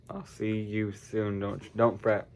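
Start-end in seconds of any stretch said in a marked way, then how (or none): none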